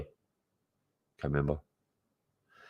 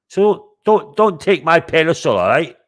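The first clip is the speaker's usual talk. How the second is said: mock warning; 9 semitones higher, 5 dB louder than usual. Real, not acted. Warning rival Scottish streamers.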